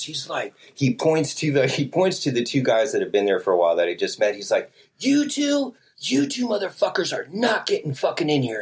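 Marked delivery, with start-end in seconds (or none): 5.0-8.6 high-pitched scolding without full volume; strained, high mock yell; mock screaming. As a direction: imitate a man yelling people away. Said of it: "he just starts fucking screaming at us".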